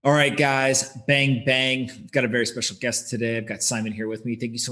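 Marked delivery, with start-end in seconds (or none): none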